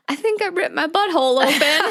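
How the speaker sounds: laughing through it. Retold or imitated. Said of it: "And I'm like".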